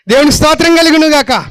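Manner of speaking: high, loud, ringing declaration of truth; fervent preaching. Acted. Preacher to the congregation, fervent loud preaching. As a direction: proclaim it to the whole church.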